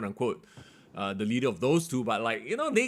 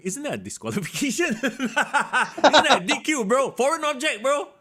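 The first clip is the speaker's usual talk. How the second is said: higher, laughing in disbelief; excited disbelief; 11 semitones higher, 5 dB louder than usual. Retold or imitated. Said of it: "I was going".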